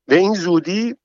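high, sharp startled question. Acted. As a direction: jolt up in surprise. Guests startled by sudden arrival. (با تعجب و صدای زیر)

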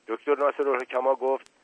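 ordinary delivery, nothing marked

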